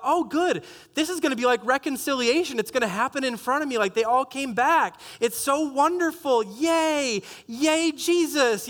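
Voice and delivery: hammed-up hopeful innocence, drawing out each yay; naive optimism. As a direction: play the gullible optimist rejoicing.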